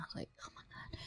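barely audible whispered aside; whispered dismay. Retold or imitated. Retelling her reaction to two guys squaring up, whispered dismay. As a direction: whisper it under your breath, aghast. Aghast aside as fight starts.

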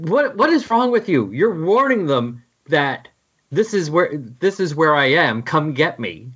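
usual speaking voice

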